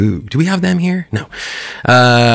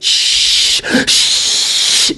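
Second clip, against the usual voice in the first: shushing. Retold or imitated. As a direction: hush them with a breathy shh. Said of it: "I had tried with a bit of".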